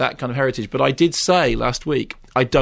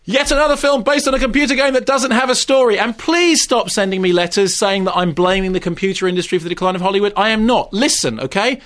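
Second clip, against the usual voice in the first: higher-pitched, insistent, indignant outburst; exasperated rant; 6 semitones higher than usual. Real, not acted. Film critic answering complaint letters about computer games, exasperated rant. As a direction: rant as if nobody ever listens. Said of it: "I bet you don't shout".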